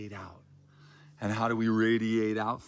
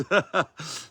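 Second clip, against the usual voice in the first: loud laugh; 6 semitones higher, 9 dB louder than usual. Real, not acted. Laughs about the exercise.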